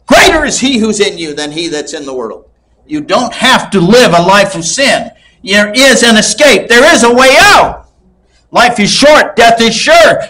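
building from firm to a high shouted climax; preaching at full force. Real, not acted. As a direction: rise to a ringing call of the congregation.

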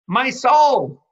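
high, loud cry of "My soul"; shouted quote. Acted. Preacher voicing God over Jesus, loud cry. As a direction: cry it out as God's own voice.

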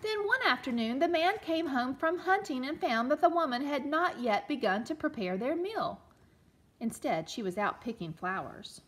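put-on storytelling read-aloud for children; gentle read-aloud storyteller; story narration. A narrator's reading. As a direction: read it like a picture book to a class. Teacher reads story aloud.

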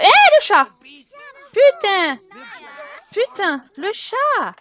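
high exasperated yells; yelling at the cat. Real, not acted. Streamer yells at the Sims cat, high and loud. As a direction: shout at the cat as it misbehaves.

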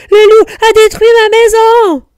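very high, loud cry of alarm; high-pitched frantic outcry; panicked, panting. A narrator's reading. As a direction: squeal it out like a frightened piglet. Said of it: "dit Nif Nif en haletant".

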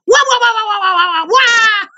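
mock baby crying. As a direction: wail like a crybaby to mock them. Mocking a beaten enemy.

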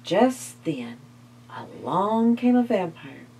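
plain reading voice

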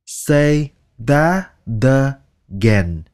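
slow it right down so each word of say that again is clear. Shown as a demonstration. Teacher slowly repeating a phrase for learners, drawn out. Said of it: "nói từ từ".

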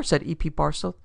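plain reading voice

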